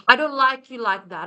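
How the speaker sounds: usual speaking voice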